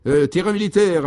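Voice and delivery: high, loud triumphant announcement. Acted. Léon announces the military ground to his companions, triumphantly. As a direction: announce it like a proud discovery. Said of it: "annonça-t-il triomphalement".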